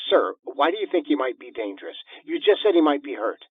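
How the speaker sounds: a hint of a brisk dispatcher; lightly voiced operator. A narrator's reading. Emergency operator questioning the caller, lightly voiced brisk operator. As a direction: question him like a busy operator.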